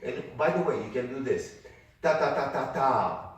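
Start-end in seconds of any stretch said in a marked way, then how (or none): 2.0-3.3 loud tapped ta syllables, higher; teacher repeating the rhythm; rhythm demo. Shown as a demonstration. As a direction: beat the rhythm clearly. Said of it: "So I said".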